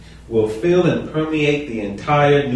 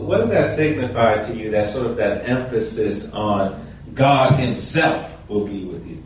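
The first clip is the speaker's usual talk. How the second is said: normal talking voice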